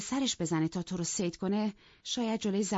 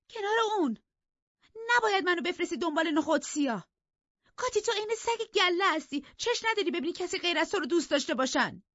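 very high-pitched angry outburst; inflamed, heated; 12 semitones higher, 3 dB louder than usual. A narrator's reading. Isabella accusing her sister-in-law of jealousy, heated. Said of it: "برافروخته ادامه داد".

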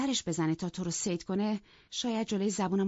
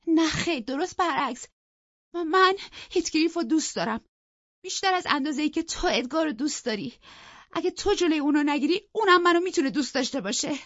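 loud, high-pitched defiance; angry; 10 semitones higher, 7 dB louder than usual. A narrator's reading. Young lady declaring her love to her sister-in-law, angry. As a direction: fire back hotly, voice raised. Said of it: "دوشیزه خانم عصبانی گفت".

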